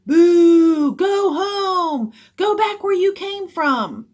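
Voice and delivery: long, loud, high jeers; hecklers' full-voiced taunts; heckling and booing. A narrator's reading. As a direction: boo and jeer from the stands. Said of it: "turned to heckling and booing".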